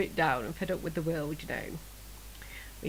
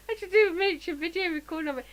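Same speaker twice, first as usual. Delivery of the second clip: excited; 9 semitones higher than usual. Real, not acted. Excited higher voice, showing.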